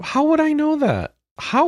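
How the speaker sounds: baffled, squeaky how-would-I-know; incredulous. Real, not acted. Baffled protest at Yoshi claim.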